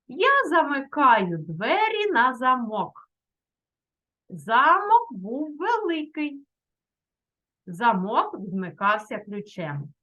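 повільно, виразно, по складах для дітей; читати речення неквапливо, вимовляючи кожне слово; повільне читання. Shown as a demonstration. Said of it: "Читаю ці речення". (повільне виразне читання)

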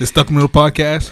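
big booming outro call; loud sign-off. Real, not acted. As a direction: shout the show name out.

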